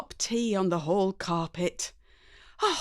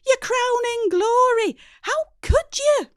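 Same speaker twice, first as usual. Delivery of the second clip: much higher, louder wail; melodramatic mum; 5 dB louder than usual. Acted. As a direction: wail it like a tragedy. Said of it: "relentless, unstoppable".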